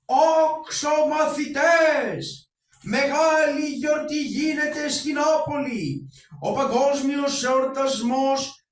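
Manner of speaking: slow, drawn-out sung cry to the whole street; town crier's call. Acted. Town crier calling out the news to everyone, slow and sung. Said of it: "Ας αρχίσω κι εγώ να ντελαλώ".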